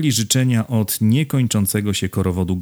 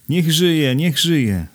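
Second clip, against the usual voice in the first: high, raised-voice hurrah; crowd's cheer; 8 semitones higher, 4 dB louder, much slower than usual. A narrator's reading. Hats waving over heads as the crowd hails him, high cheering cry. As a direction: cheer along with the whole crowd. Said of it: "krzyknął tłum".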